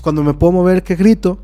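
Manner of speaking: excited telling. Retold or imitated. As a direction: push up the pitch as he breaks free.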